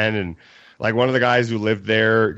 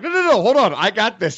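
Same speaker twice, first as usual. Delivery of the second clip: urgent calming; 9 semitones higher than usual. Retold or imitated. Calming sister down.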